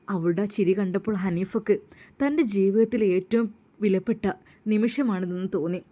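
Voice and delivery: plain reading voice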